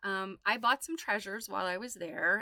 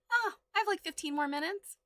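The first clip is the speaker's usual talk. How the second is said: light, high, unbothered inner voice; playing your past self who thinks there's loads of time; breezy carefree self-talk; 9 semitones higher than usual. Looking at the clock, relaxed.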